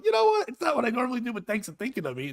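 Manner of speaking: mock polite surprise. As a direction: gush with prim, flattered politeness. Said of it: "I'm like".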